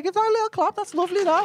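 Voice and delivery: high, mock-sweet cooing; teasing the just-married man, sugary high voice. Real, not acted. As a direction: coo over the newlyweds. Cooing at newlyweds.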